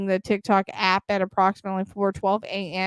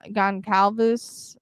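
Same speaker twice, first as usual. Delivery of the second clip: ordinary talk, nothing marked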